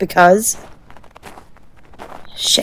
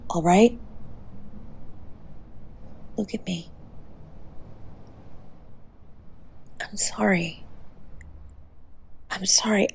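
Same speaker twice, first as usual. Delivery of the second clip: soft, much quieter apology; tender whisper; 12 dB quieter than usual. Real, not acted. Apologising softly to the fading partner, whispered. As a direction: breathe the sorry gently.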